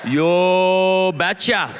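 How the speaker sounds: hugely drawn-out Norwegian reply; thick Norwegian accent. Acted. Norwegian father agreeing after a long pause, exaggerated Norwegian accent. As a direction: stretch every word in a thick Norwegian accent. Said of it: "in his thick Norwegian accent".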